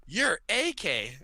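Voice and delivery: slow, high, stunned realization; astonished. Retold or imitated. Gamer realizing who his teammate is, slow astonished. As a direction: say it slowly, dawning shock. Retold realization, gasp before.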